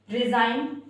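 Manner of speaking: "रिजाइन" loud, ri-zine with no g; teacher calls out "रिजाइन" without any g; silent g. Shown as a demonstration. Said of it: "जी है वो हमारा silent".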